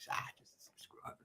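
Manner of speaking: dismissive whispered aside; hushed aside. Retold or imitated. Answering Nass about the bloke, whispered offhand aside. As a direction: whisper it under your breath to a mate.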